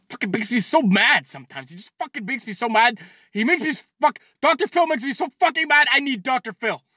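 high-pitched exasperated rant; worked-up anger. Real, not acted. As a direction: build into a comic fit of rage. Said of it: "That's how mad he makes me".

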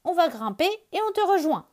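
high, loud and quick; exclaiming. Acted. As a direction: shout it eagerly, we're coming up. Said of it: "s'exclame Lapin".

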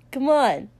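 loud impatient demand. A narrator's reading. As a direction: demand it loudly. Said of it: "Kotsky demanded".

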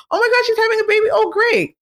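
high, quick mock delight; mock quick excitement. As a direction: sound thrilled for a moment, then move on. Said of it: "You're supposed to hear".

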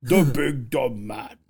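slow, drawn-out big dumb man; mock dumb brute. Mocking annoying fantasy voice actors, slow dumb-brute voice. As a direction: lumber through it as a cartoon oaf. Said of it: "for the voice actors ... so fucking annoying".

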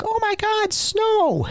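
high squealing shout of wonder; excited. Retold or imitated. Recruit gasping at first snow, squealing with joy.

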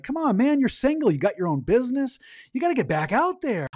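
high-pitched imitation of an eager friend; coaxing pep talk. Friend cuts in to push a single man to date, coaxing. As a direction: urge him on, voice pushed up high.

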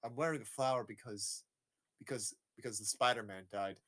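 low, soft, halting reply; imitated robot underling. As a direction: answer meekly and quietly, play-acting. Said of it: "He's like".

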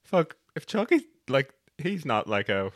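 slow, half-convinced pondering; tempted musing. Retold or imitated. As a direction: draw it out slowly, talking yourself into it. Retold tempted inner thought.

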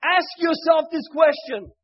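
fervent, loud sermon delivery; preaching. Acted. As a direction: preach it out with full force. Preaching the moral.

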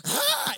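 high mock gasp of delight; excited squeal. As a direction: squeal like he's smitten. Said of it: "a girl came by that was like".

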